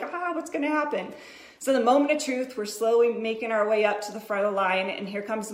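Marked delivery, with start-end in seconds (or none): 0.0-1.1 high, quick, anxious inner voice; mom's hidden panic voiced aloud. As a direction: let the worry squeak out fast. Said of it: "Mommy's like".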